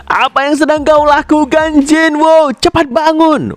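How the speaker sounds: very high-pitched half shout; setengah berteriak. A narrator's reading. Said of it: "bilang sambil setengah berteriak". (setengah berteriak dengan nada sangat tinggi)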